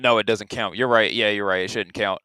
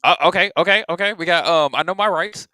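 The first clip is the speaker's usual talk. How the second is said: higher, louder, eager okays; excited; 6 semitones higher, 6 dB louder than usual. Real, not acted. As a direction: jump in excitedly. Excited by clip line.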